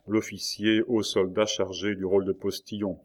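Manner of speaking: ordinary delivery, nothing marked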